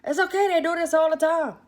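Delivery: mock a friend hollering reassurance from afar; imitated holler. Friend reassuring a screaming rider, imitated holler. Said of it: "I can hear her holler".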